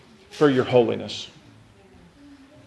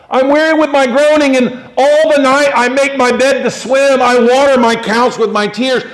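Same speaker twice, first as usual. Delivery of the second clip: high, loud cry of weariness; anguished lament; 8 semitones higher, 5 dB louder than usual. Acted. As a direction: wail the verse out to God. Voicing David's anguished psalm.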